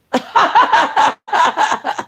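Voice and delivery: real high amused laugh. Real, not acted. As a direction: burst into laughing. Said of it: "laughing at own voices".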